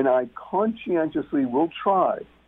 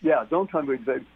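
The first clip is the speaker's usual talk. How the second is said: ordinary delivery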